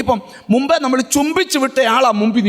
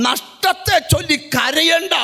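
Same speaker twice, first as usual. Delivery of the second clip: slow proclaimed shout; 5 semitones higher, slower than usual. Acted. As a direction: drag it out as a proclamation. Preacher declares slowly, shouting. (പതുക്കെ ഉച്ചത്തിൽ പ്രഖ്യാപനം)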